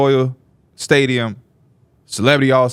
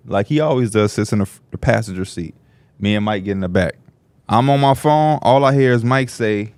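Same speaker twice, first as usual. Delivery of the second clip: ordinary delivery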